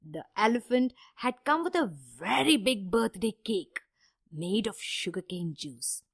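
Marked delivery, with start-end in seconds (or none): none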